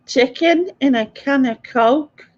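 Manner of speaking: slow, careful attempt at a Scottish accent; trying a Scottish accent. Shown as a demonstration. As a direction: stretch out «Chicken» in a mock Scottish accent. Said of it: "Does it sound right?".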